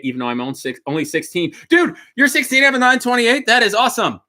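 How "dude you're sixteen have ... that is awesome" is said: high, loud excitement; amazed. Real, not acted. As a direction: lift it right up in delight. A sixteen-year-old owns a 928.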